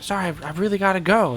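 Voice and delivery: high-pitched, flustered excuse; acted hurried apology. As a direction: play a man apologising as he flees. Runaway apologises while taking off.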